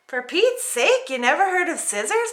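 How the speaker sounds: incredulous complaint; exasperated princess. Acted. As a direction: cry out over the braid. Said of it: "Paulina cried".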